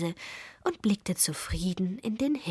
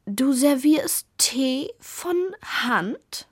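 slow, stretched and higher, in disbelief; stretched-out snooty question; drawn out; 6 semitones higher, 5 dB louder, much slower than usual. A narrator's reading. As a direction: draw out each word with raised-eyebrow disdain. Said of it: "sagte gedehnt".

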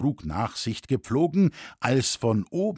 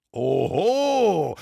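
loud, high, drawn-out objection; indignant exclamation; called-out protest; 6 semitones higher, 5 dB louder than usual. A narrator's reading. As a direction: challenge him with a loud cry. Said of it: "rief nun der Schmied".

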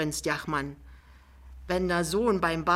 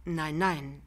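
drawn out. Acted. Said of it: "sagt Pinneberg sehr gedehnt".

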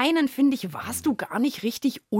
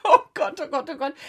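higher and louder, then a laugh; startled; 4 semitones higher, 4 dB louder than usual. Real, not acted. Host reacting as her colleague surfaces, startled. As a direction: gasp it out, shocked but amused.

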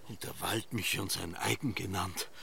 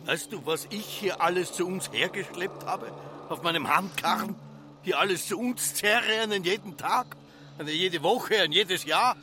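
high agitated ranting; 8 semitones higher, 3 dB louder than usual. Acted. Josef rants about his hoard.

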